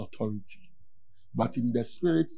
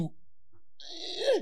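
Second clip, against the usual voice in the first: choked squeak; 9 dB quieter than usual. Retold or imitated. Voice stuck, cannot speak.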